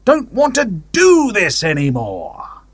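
slow, high-pitched mock yell; retold yell. A narrator's reading. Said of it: "was yelling".

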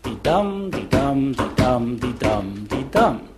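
"Dee-dum" weak then strong, five times; iambic pentameter. Shown as a demonstration. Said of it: "iambic pentameter".

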